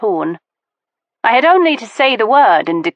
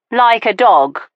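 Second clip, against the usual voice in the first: ordinary delivery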